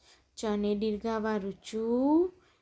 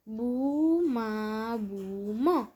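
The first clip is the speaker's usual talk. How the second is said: બુ, મા, બુ, મ each drawn out slowly; long held syllables in બુ, મા, બુ, મ; much slower than usual. Shown as a demonstration. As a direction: sound out બુ, મા, બુ, મ one syllable at a time. Syllable-by-syllable reading, then whole word.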